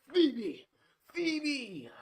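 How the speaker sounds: put-on high, loud boy's holler; screamed call. A narrator's reading. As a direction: scream the name out to find someone far away. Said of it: "huge breath and screamed".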